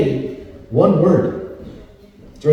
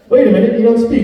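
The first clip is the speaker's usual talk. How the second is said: ordinary talk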